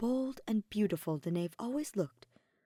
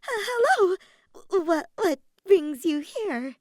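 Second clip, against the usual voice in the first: high, loud, slow stutter; nervous giggle into a squeaky stammer; stammering nervously; 11 semitones higher, 10 dB louder, slower than usual. A narrator's reading. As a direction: trip over the words in a fluster. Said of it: "she stammered".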